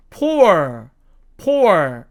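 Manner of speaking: POOR with the r sounded; American poor variant. Shown as a demonstration. Teacher showing the other American way, POOR with r. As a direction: finish POOR on a clear r. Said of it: "미국식으로 발음을 하면".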